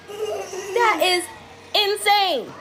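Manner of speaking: speaking to parents, appalled by the cartoon; outraged disbelief. Real, not acted. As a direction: stretch it out in horror. Reacting to the creepy cartoon.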